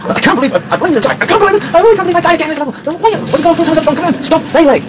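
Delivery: high-pitched, sped-up rant; frantic high fast-forward voice; fast motion. Pretend. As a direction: rattle out the complaint in fast motion. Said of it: "In fast motion".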